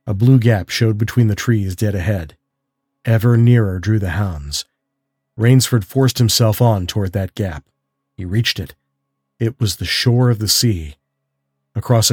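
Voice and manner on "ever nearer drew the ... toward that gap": usual speaking voice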